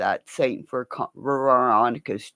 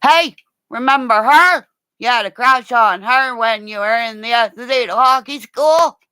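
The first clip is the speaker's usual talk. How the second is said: high, loud teasing call; mocking shout; 9 semitones higher, 5 dB louder, faster than usual. Teasing son in front of cops, high loud mocking shout. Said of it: "as he went".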